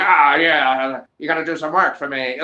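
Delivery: a lightly voiced old-timey mafia guy, hammed up; a hint of an Irish actor playing a gangster; mild mock mob boss, overdone; over-the-top mobster accent. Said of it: "He's, like, going over the top".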